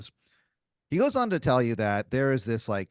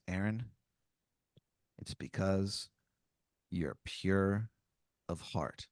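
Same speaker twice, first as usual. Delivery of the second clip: low, much softer hushed confiding; hushed Zeus; 3 semitones lower, 8 dB quieter, slower than usual. Acted. Zeus confides to Aaron, low and hushed. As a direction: lean in and say it low and secret.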